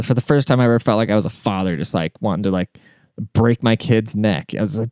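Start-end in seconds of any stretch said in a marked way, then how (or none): none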